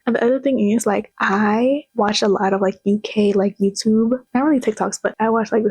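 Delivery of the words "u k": ordinary delivery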